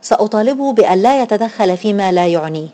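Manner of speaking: plain reading voice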